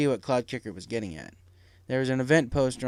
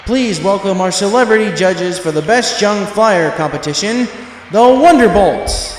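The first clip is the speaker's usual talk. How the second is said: slow, booming announcer call, higher and much louder; stadium announcer; 7 semitones higher, 11 dB louder than usual. A narrator's reading. Announcer welcomes the crowd, booming and slow. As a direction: boom it out over the amplifier like a showman.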